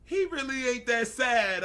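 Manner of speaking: high, loud teasing insistence; amused outcry. Real, not acted. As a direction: call it out, about to laugh. Teasing exclamation breaking into laughter.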